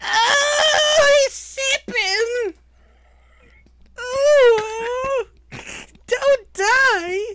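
very high, loud, slow shrieks; creepy shrieking. Acted. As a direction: wail it in a creepy falsetto. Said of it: "that fucking creepy ass voice".

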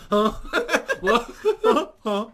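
startled mock huh. Acting startled man.

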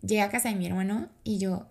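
normal talking voice

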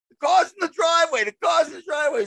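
repeated high shouted insistence; retold shout to his mother. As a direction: shout it twice, pleading. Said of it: "I'm saying".

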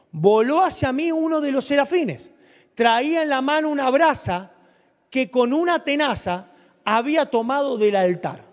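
high, loud, fervent preaching; preaching at full force. Acted. Preacher telling the congregation of the seraph, high and loud. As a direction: lift the whole church with it.

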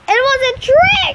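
high, loud protest; indignant yell, pitch way up; outraged shout. A narrator's reading. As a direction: shout it in furious disbelief. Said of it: "she shouted".

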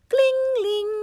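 very high, loud ringing imitation; doorbell ring. A narrator's reading. Doorbell wakes rabbit, high ringing noise. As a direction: sing out a high doorbell chime.

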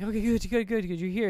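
quick-fire eager reassurance, pitched high. Retold or imitated. Woman talking to Charlie at the table, eager. Acting out medium's eager reply.

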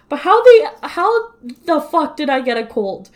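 exasperated how-did-this-happen; incredulous outburst. Real, not acted. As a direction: voice jumps up in disbelief. Baffled at catching a cold.

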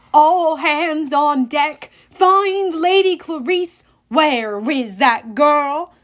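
loud, slow ship captain's bellow; gravelly captain. A narrator's reading. Captain calling all hands on deck, loud. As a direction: rouse the whole crew. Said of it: "The captain's gravelly voice".

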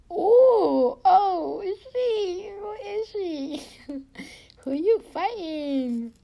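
loud, high, drawn-out playful wail; silly hooting. Pretend. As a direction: hoot it way up high, clowning. Clowning loudly in a silly voice.

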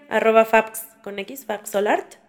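usual speaking voice

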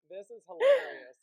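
high, loud burst of laughter through words; laughing loudly. Real, not acted. Laughing at fire alarms.

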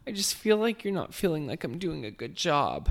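high-pitched mock whining, drunk and emotional; Tinsley Mortimer. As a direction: imitate an upset, wasted daughter complaining to her mother. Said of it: "absolutely wasted and emotional".

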